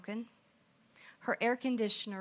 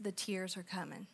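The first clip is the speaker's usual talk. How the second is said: usual speaking voice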